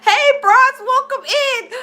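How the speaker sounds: high, loud greeting broken by laughter; real laughter spilling into the hello; laughing greeting. Real, not acted. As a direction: welcome them in while still cracking up. Streamer greets viewers still laughing.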